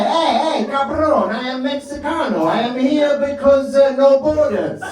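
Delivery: high mock-Mexican accent; a botched Mexican accent that drifts into Italian. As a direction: protest in a hammed-up Mexican accent, pitched high. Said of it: "that Mexican accent sounds Italian".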